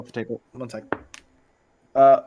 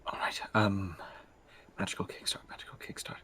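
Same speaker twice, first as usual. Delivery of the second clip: whispering; 6 semitones lower, 15 dB quieter than usual. Acted. Whispered spell words.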